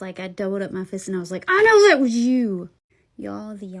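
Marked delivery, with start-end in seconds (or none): 1.5-2.7 loud furious accusation; teen rounds on the prankster ghost, furious; angry. Retold or imitated. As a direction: confront the ghost, fists clenched. Fists doubled, confronting the ghost.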